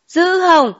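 a high, astonished "Dữ không?"; amazed. A narrator's reading. Sister-in-law hears the uncle's big wage, astonished. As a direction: gasp it out in disbelief.

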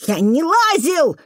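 put-on high, drawn-out boy's cry; desperate cry. A narrator's reading. Boy insisting to the janitor he didn't climb, desperate. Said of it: "отчаянно крикнул мальчишка".

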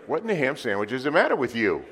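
loud, high mock scolding; hammed-up disbelief at a neighbor; mock exasperation. Feeds congregation a mock scolding line.

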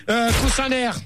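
usual speaking voice

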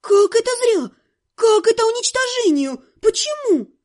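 high, loud astonished protest; shocked disbelief. A narrator's reading. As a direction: gasp it out in disbelief. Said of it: "у меня челюсть отпала".

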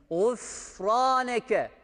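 Gufraneke drawn out slowly; slow recitation. Shown as a demonstration. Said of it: "Gufraneke deniyor". (Gufraneke'yi yavaşça, uzatarak oku)

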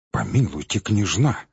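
ordinary delivery

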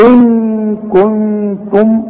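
إن كنتم with the ن hidden in a long nasal hum; إن كنتم with a held nasal ghunna. Shown as a demonstration. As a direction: hum through the نون in إن كنتم slowly. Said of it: "نون وبعدها كاف".

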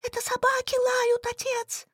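put-on high, thin young woman's voice; thin girlish voice. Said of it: "ответила сама себе тоненько".

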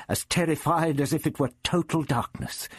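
ordinary delivery, nothing marked

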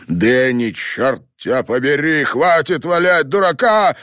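loud, rising to a high enraged cry; furious yell. A narrator's reading. Father cursing at his son's coffin, a furious rising yell. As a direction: scold him at full volume. Father yelling at dead son.

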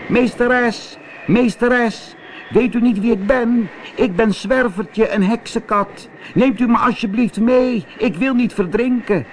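high-pitched pleading cat voice; calling cat. Acted. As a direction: call up to the witch, high and begging. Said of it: "hoorden hem roepen". (hoog, smekend roepende kat)

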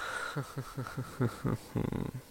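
breathy quiet chuckle; soft laugh. Real, not acted. Reacting to five hours.

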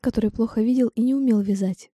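usual speaking voice

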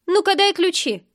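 high-pitched bossy order; pushy demand. A narrator's reading. Drunk woman demands keys from the heroine, pushy. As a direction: demand it sharply, brooking no refusal.

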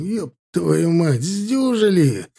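high, drawn-out cry of triumph; slow, elated exclamation; exultant outburst. Acted. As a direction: stretch it out in victorious relief. Commander exults after battle ends.

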